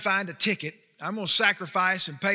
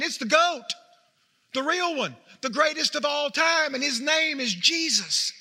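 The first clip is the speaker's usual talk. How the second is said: preaching at full force; 7 semitones higher than usual. Acted. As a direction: preach it out, lifting toward the big name. Rising sermon climax on Jesus.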